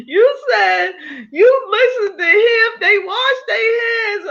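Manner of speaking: high, squealing laughter through the words; laughing disbelief. Real, not acted. Host laughing in disbelief at the guest's confession. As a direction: cackle in disbelief as you repeat it. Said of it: "What you say".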